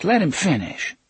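sharp, high snapped order; snapping. A narrator's reading. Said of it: "Susanna snapped".

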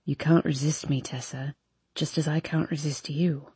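deep, soft, intimate man's voice; low seductive murmur. A narrator's reading. Hardin's voice, very soft.

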